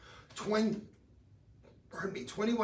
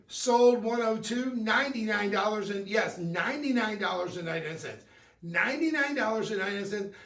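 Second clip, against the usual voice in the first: higher and louder, pushing the deal hard; hyped sales pitch; 4 semitones higher, 5 dB louder than usual. Acted. Shopping host hypes a ring to viewers, excited pitch. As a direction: sell it like the clock is running out.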